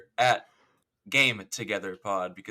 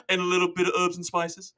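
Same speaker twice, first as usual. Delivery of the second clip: higher put-on character voice; cartoon Australian afterthought, pitched up; imitated show character; 7 semitones higher than usual. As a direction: add it as a comic character. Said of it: "And then he's like".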